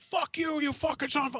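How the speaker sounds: high, hammed-up cursing out; mock furious yell. Imagining cursing out the manager, mock furious. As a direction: pretend to lose it.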